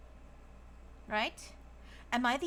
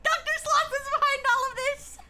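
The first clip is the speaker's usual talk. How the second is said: high, loud hammed-up gasp of discovery; mock shocked gasp; 7 dB louder than usual. Streamer hams up the villain reveal in a loud, high mock-shocked voice. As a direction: gasp it out like the twist just landed.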